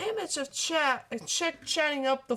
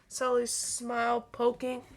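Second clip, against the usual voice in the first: ordinary delivery, nothing marked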